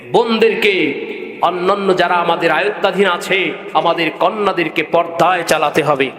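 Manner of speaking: ordinary talk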